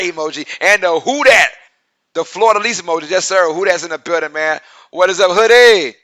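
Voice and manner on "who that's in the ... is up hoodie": usual speaking voice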